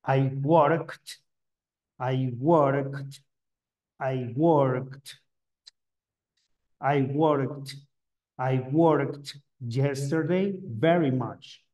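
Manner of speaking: "worked" in one syllable ending in a T; teacher modelling "worked" as "workt". Shown as a demonstration. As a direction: land a distinct T right after the K in "worked". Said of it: "va a sonar como una T".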